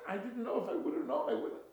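high, loud, protesting excuse; panicked pleading. Acted. Innkeeper begs the scholar's forgiveness after refusal, frantic pleading. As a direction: insist desperately that you had no idea.

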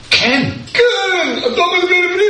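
keen Israeli passenger answering a stranger; eager Israeli. Retold or imitated. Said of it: "must have heard the Israeli accent".

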